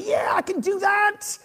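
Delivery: high-pitched, overeager yes; carried-away enthusiasm. Retold or imitated. Preacher mimicking himself saying yes, overenthusiastic. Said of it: "sometimes my enthusiasm will carry me".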